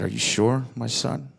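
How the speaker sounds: low, soft fatherly question; quiet deity addressing his son; gentle god voice. Retold or imitated. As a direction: ask it like a kindly cosmic father. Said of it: "The universe said".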